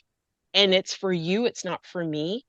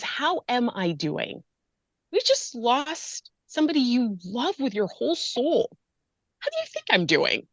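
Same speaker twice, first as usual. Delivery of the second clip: higher, indignant griever's retort; snappy; 5 semitones higher than usual. Acted. Griever answering someone who asked "how are you", snappy. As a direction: answer back snappily, pitched up in exasperation. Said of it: "it sounds like you're snappy".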